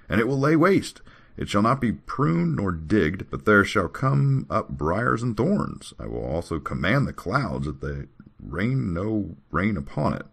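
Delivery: slow, weighty declamation; drawn-out recitation, starting high; scripture reading. Acted. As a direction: read it out like a prophecy. Reads scripture aloud.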